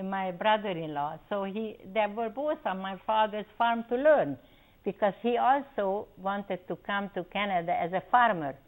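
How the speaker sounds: usual speaking voice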